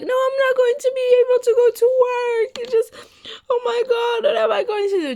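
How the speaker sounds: high, loud melodramatic wail; overreacting. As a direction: mimic dad making a huge fuss. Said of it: "Just overreacting like".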